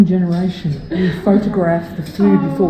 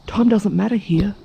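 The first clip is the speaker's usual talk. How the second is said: usual speaking voice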